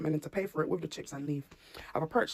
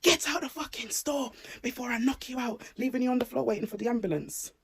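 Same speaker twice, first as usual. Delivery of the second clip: higher, imitated menacing yell; mock the manager's threat as a yell; threatening shout; 6 semitones higher than usual. Shopper imitating the store manager threatening her, mock yell. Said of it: "then he shouted".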